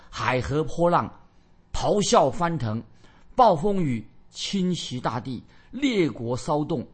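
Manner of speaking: slow, emphatic declaiming phrase by phrase; weighty, stretched-out announcement; ominous forecast proclamation. Acted. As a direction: proclaim the coming storm in drawn-out words.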